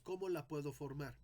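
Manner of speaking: usual speaking voice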